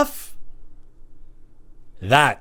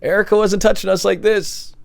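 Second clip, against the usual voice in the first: higher, awkward protest; uncomfortable student; 8 semitones higher than usual. Retold or imitated. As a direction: squirm it out, higher. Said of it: "it's like".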